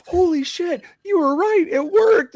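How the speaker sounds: high, loud squeal of disbelief; stunned disbelief. Retold or imitated. Bear staring at the money raining down, high amazed squeal. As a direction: shriek it like you can't believe it. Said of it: "He's like".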